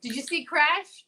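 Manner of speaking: ordinary talk, nothing marked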